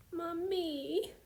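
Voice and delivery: put-on a small girl's screaming sob; screaming toddler. A narrator's reading. Toddler screaming for mummy, mild high cry. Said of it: "she screamed".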